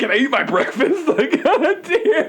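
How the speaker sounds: high, loud whine through laughter; a put-upon diner giggling; laughing whine. Retold or imitated. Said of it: "I'm just sitting there like".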